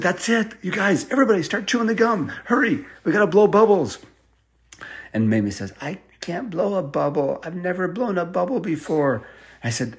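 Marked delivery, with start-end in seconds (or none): 0.0-4.0 high, eager call to hurry; rally the kids to chew fast; bright urgent push to get going; excited urging. Acted. Urging everyone to hurry and float. 5.8-9.2 raised, anxious little voice; a small scared confession; worried child. Acted.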